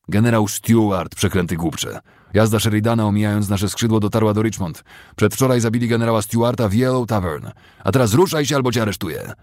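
high, loud furious tirade; furious officer. A narrator's reading. Captain threatening to arrest a straggler, furious. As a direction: lash him with the news and the threat.

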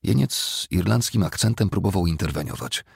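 usual speaking voice

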